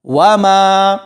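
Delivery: وما slowly, with a long held final aa; stretched madd. Shown as a demonstration. Teacher joining meem to alif, vowel stretched. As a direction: draw out the maa of وما on a level tone. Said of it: "کھینچ کر پڑھا جائے گا".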